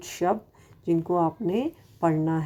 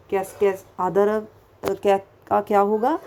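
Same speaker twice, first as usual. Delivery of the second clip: ordinary talk, nothing marked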